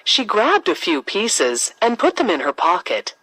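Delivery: plain reading voice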